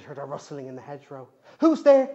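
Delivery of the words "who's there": loud, high frightened call. Acted. Bridget calls out into the dark woods. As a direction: shout into the dark. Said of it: "she called out".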